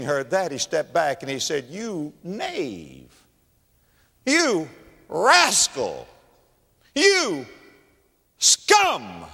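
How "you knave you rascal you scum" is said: high, drawn-out insults with long pauses; hammed-up indignant king; mock royal outrage. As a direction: hurl each insult separately, voice raised. King acts out rebuking confessed prisoner.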